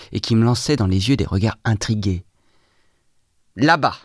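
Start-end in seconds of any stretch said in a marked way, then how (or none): 3.6-4.0 high, sudden exclamation; exclaiming. A narrator's reading. Narrator alone resolving to follow them, exclaiming. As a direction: cry it out with resolve. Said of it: "m'écriai-je".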